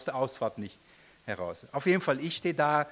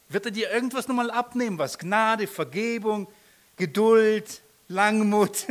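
higher, louder, rushed pleading; anguished inner question; 6 semitones higher, 5 dB louder than usual. Retold or imitated. Preacher asking himself if neighbour will trust him, anguished. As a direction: doubt his witness in a rising voice.

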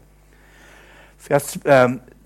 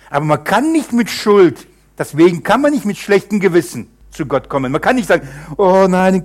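loud, higher insistent preaching; full-force preaching; 6 semitones higher, 6 dB louder than usual. Acted. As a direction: hammer it home to the congregation. (Predigt mit voller Kraft)

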